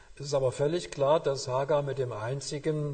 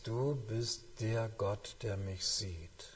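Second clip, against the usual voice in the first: softer, slow, reverent; prayerful hush; 3 semitones lower, 8 dB quieter, slower than usual. Shown as a demonstration. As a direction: say it like a quiet prayer. Prayerful reciting of verse. (leise, langsam, andächtig gebetet)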